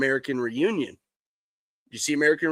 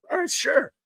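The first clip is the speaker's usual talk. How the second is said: high mock agreement; 12 semitones higher than usual. Character agrees in movie retelling.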